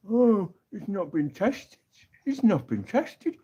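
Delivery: high, slow, whiny sceptic voice; mock anti-vaxxer. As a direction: sneer it like a conspiracy crank. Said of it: "knobheads coming out going".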